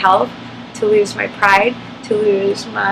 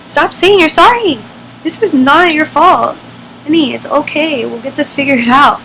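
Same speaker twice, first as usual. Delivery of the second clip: reassuring friend; 5 semitones higher, 5 dB louder than usual. Retold or imitated. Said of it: "what she kept saying".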